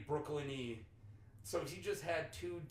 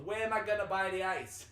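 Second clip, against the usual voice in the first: loud, higher, exasperated Boston voice; imitated Boston accent; 4 semitones higher, 6 dB louder than usual. Boston guy arguing about where ice is sold, exasperated Boston accent. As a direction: demand it like a baffled Bostonian. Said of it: "There was a Boston guy".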